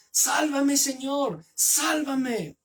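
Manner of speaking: high, pleading cry for help; desperate cry. Acted. Peter sinking in the waves begs his lord, a high desperate cry. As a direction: beg to be saved while sinking. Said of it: "Entonces gritó diciendo".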